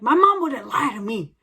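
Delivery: insistent. Retold or imitated. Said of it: "I was a stickler for Santa".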